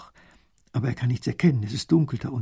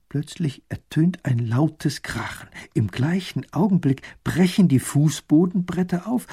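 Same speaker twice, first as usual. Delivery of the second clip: ordinary delivery, nothing marked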